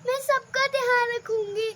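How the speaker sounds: high, crying reply; high, loud, weeping "हाँ पापा"; tearful promise. Acted. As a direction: promise him through tears. Bride tearfully promises father.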